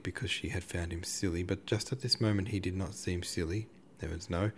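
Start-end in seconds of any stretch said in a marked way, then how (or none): none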